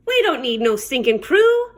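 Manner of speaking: plain reading voice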